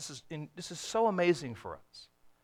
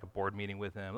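ordinary delivery

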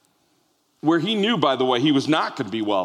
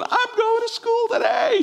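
put-on much higher, slow sing-song child voice; excited little girl; slower than usual. Daughter at the breakfast table, high excited child voice. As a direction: announce it like a thrilled five-year-old. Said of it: "She's going".

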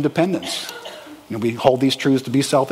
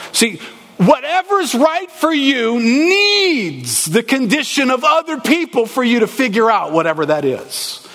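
high, slow voice cracking with emotion; preaching near tears; voice breaking; 8 semitones higher than usual. Real, not acted. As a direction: let the voice break as you plead.

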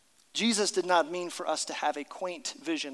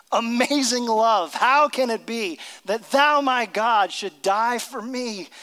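slow, lifted, solemn hymn recital; recited hymn; 4 semitones higher, 5 dB louder than usual. Retold or imitated. Pastor to congregation, quoting Wesley, solemn slow recital. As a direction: intone the old hymn words slowly. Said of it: "he says".

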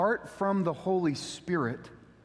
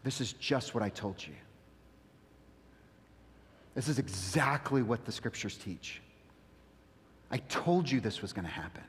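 lower, much softer intimate telling; soft hushed Jesus voice; quiet Jesus voice; 8 semitones lower, 7 dB quieter than usual. Retold or imitated. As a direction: lean in and speak gently to friends. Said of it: "And then he says".

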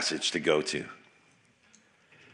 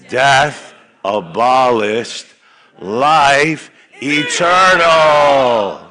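very slow, loud, "eternal" soaring high; drawn-out shouted chant; triumphant shout; 4 semitones higher, 8 dB louder, much slower than usual. Shown as a demonstration. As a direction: end on a victory cry. Group chant climax.

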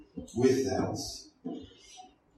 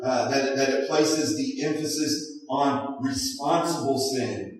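ordinary talk, nothing marked

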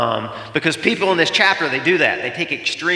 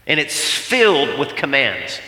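ordinary delivery

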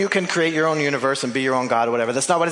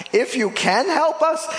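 much higher, mocking "If you can help us"; mock disbelief, pitched way up; incredulous; 10 semitones higher than usual. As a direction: scoff at the idea, pitch jumping up. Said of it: "You know who I am, right?".

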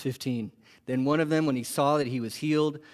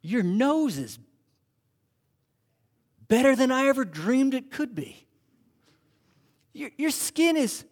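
rising higher and higher in wonder; amazed healed leper; 8 semitones higher than usual. Acted. Joe marvelling at Fred's healed skin, voice climbing in wonder. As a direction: marvel at a friend's new face.

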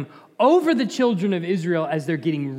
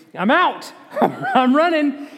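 high, loud, laughing exclamation; mock alarm; 8 semitones higher, 5 dB louder, slower than usual. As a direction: bail out in comic panic. Jokes about fleeing talking donkey.

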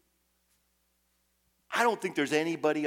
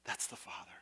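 whisper; 16 dB quieter than usual. Real, not acted. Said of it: "whispered aside".

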